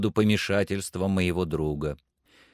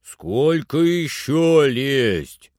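slow, drawn-out call from far up; raised pitch and level, words stretched to carry; distant voice; 4 semitones higher, 5 dB louder, much slower than usual. A narrator's reading. Climber calls down from high in the tree, distant voice. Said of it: "как будто издалека".

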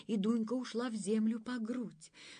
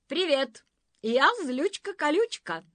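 a hint of a haughty thorn-lady, higher and louder; bold prickly witch; 8 semitones higher, 10 dB louder than usual. A narrator's reading. Prickly thorn-lady introducing herself to Dunka. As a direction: announce yourself with a prickly swagger. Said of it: "представилась она".

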